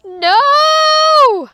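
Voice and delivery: long, high, loud scream of refusal; panicked yell; horrified cry. Acted. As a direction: cry out as someone falls. Big Bark falls, crowd panics.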